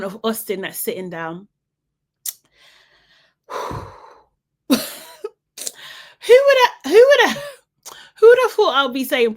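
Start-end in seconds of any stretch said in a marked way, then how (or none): 6.3-9.4 disbelieving, high and loud; who would have thought, said in squealing disbelief; high incredulous exclaim. Real, not acted. Disbelief at rooting for Austin.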